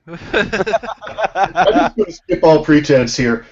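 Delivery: normal talking voice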